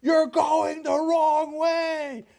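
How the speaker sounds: high, slow, loud shout; drawn-out honking-driver yell; yelling warning. Retold or imitated. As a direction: holler it across the road at the wrong-way car. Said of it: "the guys on the other side of the road are yelling".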